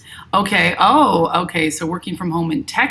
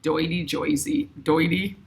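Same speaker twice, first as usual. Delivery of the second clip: put-on a light New York accent, quiet and playful; softly mocking New York accent; 5 dB quieter than usual. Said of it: "I got the New York accent".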